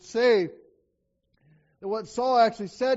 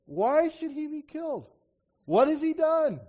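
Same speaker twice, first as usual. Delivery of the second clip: pleading son; 6 semitones higher, 3 dB louder than usual. Acted. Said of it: "Jonathan answered Saul his father".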